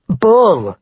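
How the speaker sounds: loud, high outburst of protest; growled objection. A narrator's reading. Marguerite protesting being left with his father, growling objection. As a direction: object sharply and forcefully. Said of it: "Marguerite growled at that".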